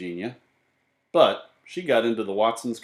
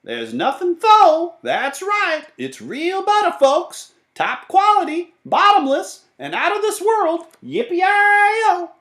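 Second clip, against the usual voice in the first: loud, high hawker's holler; whooping high-pitched sales pitch; cowboy shout; 9 dB louder, slower than usual. A narrator's reading. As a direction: shout your wares like a cowboy vendor. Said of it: "he shouted".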